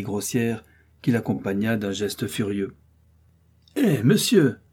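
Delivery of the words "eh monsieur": sharp high call across the yard; cry out. A narrator's reading. D'Artagnan hails the hidden mocker, loud and angry. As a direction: shout up at the window, offended. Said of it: "s'écria-t-il".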